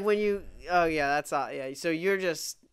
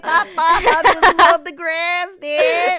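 hammy country voice; 11 semitones higher, 10 dB louder than usual. Pretend. Mock accent teasing.

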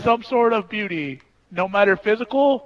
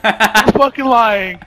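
loud disbelief; 9 dB louder, much slower than usual. Real, not acted. Gamer disbelief shouted.

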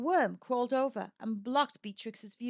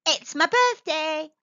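high, louder, drawn-out birthday cheer; excited; 6 semitones higher, 6 dB louder than usual. A narrator's reading. Bee jumping up on her birthday, excited. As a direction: announce it with excitement, stretched out. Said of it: "jumping up with excitement".

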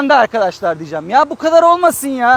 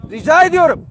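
ordinary delivery

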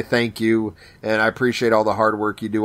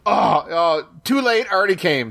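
mock overcome; 7 semitones higher than usual. Acting out being overwhelmed by accent.

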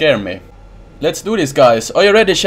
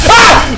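sudden high, loud yelp; real jump-scare scream; startled scream; 7 dB louder than usual. Real, not acted. Jump scare in horror game.

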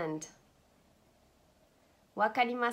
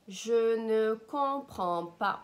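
slow, louder, word-by-word demonstration; slow model; 5 dB louder, slower than usual. Shown as a demonstration. Language teacher modelling a French phrase for learners, slowly and loudly. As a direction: stretch each word out for the learner.

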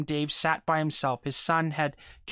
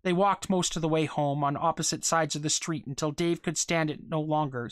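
ordinary delivery, nothing marked

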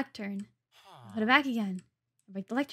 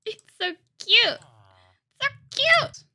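very high, loud cutesy squeal; adoring squeal; 11 semitones higher, 8 dB louder than usual. Real, not acted. Gamer gushing over a little house, adoring squeal. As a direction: gush in a rising squeal of delight.

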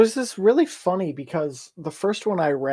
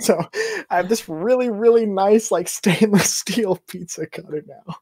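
high and loud, laughing; excited; 6 semitones higher, 6 dB louder than usual. Real, not acted. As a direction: proudly gush about the cutter. Bragging about new pizza cutter.

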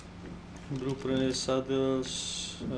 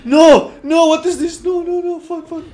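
very high, loud panicked yelling; real gamer panic, screaming no; panicked shouting; 16 dB louder than usual. Real, not acted. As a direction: shout in alarm, right up high. Sudden danger in the game.

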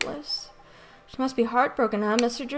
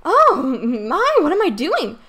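higher, louder, bustling and flustered; flustered wife; 6 semitones higher, 5 dB louder than usual. Acted. Revived wife rushing to do her shopping, flustered and excited. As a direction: snap awake and hurry off in a fluster.

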